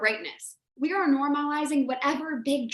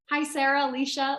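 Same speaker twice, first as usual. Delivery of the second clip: ordinary talk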